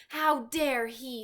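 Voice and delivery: put-on indignant girl; indignant cry. A narrator's reading. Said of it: "Sophie cried".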